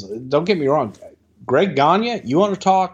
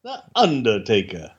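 deep announcer voice; 4 semitones lower than usual. Pretend. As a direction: say it like a doom-laden reveal. Low dramatic echo.

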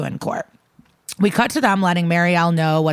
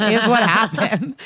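real laugh breaking into the words; 4 semitones higher than usual. Real, not acted. Laughing while telling the teen's sassy answer, amused. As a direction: talk through a laugh, pitch up.

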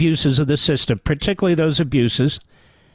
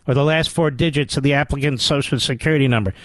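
ordinary delivery

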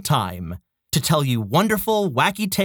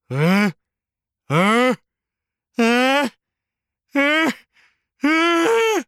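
each huh higher and louder; building sneeze; 7 semitones higher, 4 dB louder, much slower than usual. Acted. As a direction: wind up to a sneeze, climbing. Flamingo before sneeze, rising.

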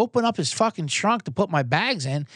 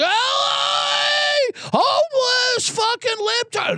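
much higher, shouted, slowed-down caricature; mock outraged conservative; much slower than usual. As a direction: scream it like a horrified Fox News viewer. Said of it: "They have heart attacks".

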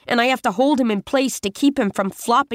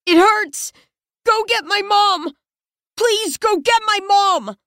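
put-on high, pleading childlike cry; pleading for mom; 10 semitones higher than usual. Wounded boy pleading for his mom, high and desperate. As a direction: beg for your mother through the pain. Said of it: "He's pleading".

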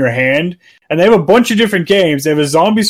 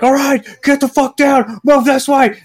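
higher, urgent tactical commands; half-joking drill sergeant calling the moves; mock commando orders; 10 semitones higher than usual. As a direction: play the squad leader barking at his team. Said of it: "you're like".

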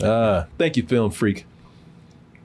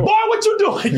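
high yelled scold; mock angry yell. Reacting to the guys on his lawn, a high mock yell. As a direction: snap at him like a startled homeowner. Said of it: "an initial reaction".

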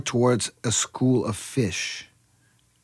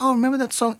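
dad quoted; 12 semitones higher, faster than usual. Retold or imitated. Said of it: "It's like".

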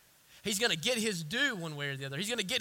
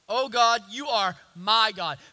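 louder psalm cry; 3 semitones higher, 9 dB louder than usual. Acted. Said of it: "He says".

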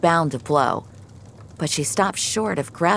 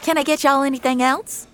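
high-pitched diner waitress; 11 semitones higher, 3 dB louder, faster than usual. Acted. Waitress to customers at their booth, high service voice. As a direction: serve the table in a high waitress voice.

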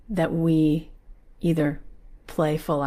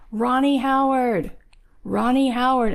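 mock scream; 7 semitones higher, 3 dB louder than usual. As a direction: imitate hollering a name, without full volume. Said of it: "he screams out the window".

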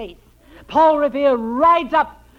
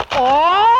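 startled yelp; 3 semitones higher, 8 dB louder than usual. Acted. Costello's neck squeezed for an auk imitation.

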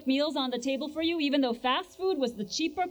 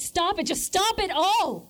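angry; 5 semitones higher, slower than usual. Real, not acted. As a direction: make him stop, furious. Mother demands he stop.

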